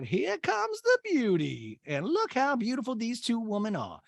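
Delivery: high, grandly stretched mock greeting; hammed-up admiring mother impression; Patricia. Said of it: "Miss Patricia's like".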